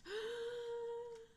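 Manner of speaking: long, high audible gasp in; drawn-out inhale; deep breath. A narrator's reading. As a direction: suck in a huge breath before shouting. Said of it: "they all took a deep breath".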